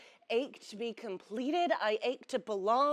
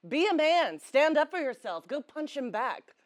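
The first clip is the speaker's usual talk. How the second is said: louder, higher commands to toughen up; gruff-command role; 5 semitones higher, 6 dB louder than usual. Acted. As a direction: order someone to man up, loudly.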